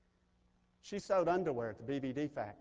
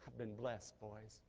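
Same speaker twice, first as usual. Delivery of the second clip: put-on soft and low, almost fading on boys; Jacob, hushed; 4 semitones lower, 11 dB quieter, slower than usual. Jacob reassuring his worried sons, soft and low. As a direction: let it drop to a hush. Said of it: "And he said".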